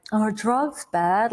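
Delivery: slow, drawn-out silly question; mock-naive question. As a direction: drag out an obvious question. Mimics an obvious rhetorical question.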